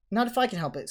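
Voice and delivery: high, firm, mildly grim. A narrator's reading. Hermione answering the threat of expulsion, grim. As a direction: say it with grim resolve. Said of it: "said Hermione grimly".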